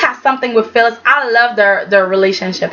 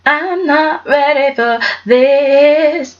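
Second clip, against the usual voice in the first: slowly, like bracing for bad news; slow drawn-out dread; much slower than usual. Repeated drawn out.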